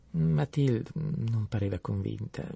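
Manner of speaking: ordinary delivery, nothing marked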